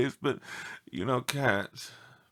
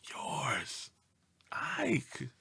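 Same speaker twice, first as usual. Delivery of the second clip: soft whispered signature; whispered sign-off; 11 dB quieter than usual. Acted. Dog signs off letter.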